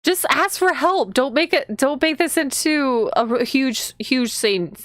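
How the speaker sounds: high-pitched, urgent urging; exasperated pleading. Real, not acted. Player begging the heroine to ask for help, exasperated plea.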